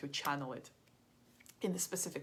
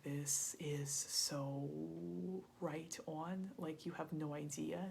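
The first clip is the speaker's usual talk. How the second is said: awed whisper; 8 dB quieter, slower than usual. Retold or imitated. Woman amazed by the reading.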